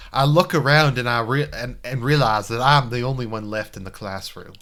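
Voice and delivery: put-on old-school Virginia gentleman; hammy Southern accent from a Civil War movie. Narrating his thoughts about a worried friend, Virginia accent. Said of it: "old school Virginia".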